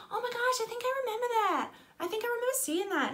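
very high, excited star voice. Retold or imitated. Star remembers the fan's story, high excited impression. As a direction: imitate a pop star's squealed recognition. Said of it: "And a bit more [name]-y".

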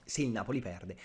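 ordinary talk, nothing marked

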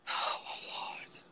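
breathless whispered oh Lord; hushed exasperated aside. Real, not acted.

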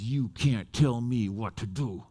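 low, slow mock menace; retold defiant stare-down. Woman looks preacher right in the face, slow low defiance. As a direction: draw it out low and deliberate. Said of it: "looks me right in the face".

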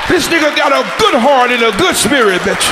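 high hammed-up indignation; mock outraged yell. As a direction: turn on her for the new friend. Now siding with the Crip, yelling at her.

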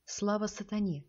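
ordinary delivery

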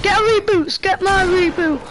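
loud urgent yell at teammates; high, loud and frantic; shouting. Real, not acted. Urgent call to teammates mid-game.

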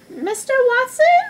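high-pitched questioning wife; wife's voice. A narrator's reading. Missus Watson waking her husband, high voice. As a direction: call his name in a high, rising voice.

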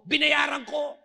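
shouts it out, loud and high; excited shout. Retold or imitated. Pastor shouting joyful news to the indebted man. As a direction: bursts out with the good news. Pastor announces paying debt.

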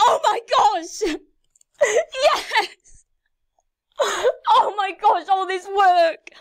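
very high, loud, laughing and sobbing; crying with joy. Real, not acted. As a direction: scream with happy tears. Celebrating halfway to ten K.

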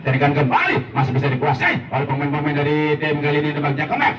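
ordinary talk

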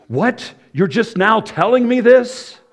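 high, loud mock astonishment; mock shock. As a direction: play a scandalised listener, hammed up.

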